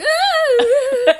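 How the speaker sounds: high laughing imitation of a sob; mock crying wail through a laugh. Daughter reenacting her crying to mom, laughing. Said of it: "I'm like".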